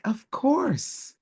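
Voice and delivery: the husband giving in, lightly mimicked; husband's reply. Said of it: "And he was like".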